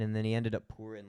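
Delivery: normal talking voice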